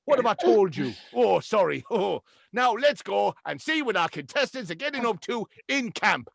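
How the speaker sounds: high, giggly TV double act; bouncy presenter patter with chuckles; Ant and Dec. Acted. Hosts bantering, then linking to camp, high and giggly. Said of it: "and I'm Declan".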